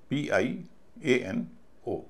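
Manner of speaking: very slow "पी आई ... ए एन ... ओ" in three separate groups; syllable-by-syllable spelling. Shown as a demonstration. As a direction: spell out "पी आई ए एन ओ", pausing after "आई" and after "एन". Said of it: "piano के तीन syllable होंगे". ("पी आई, ए एन, ओ" को तीन हिस्सों में रुक-रुककर बोलें)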